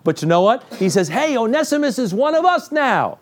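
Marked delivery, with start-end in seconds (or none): 1.1-3.2 cheerful Paul. Retold or imitated.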